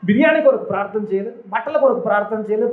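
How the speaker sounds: normal talking voice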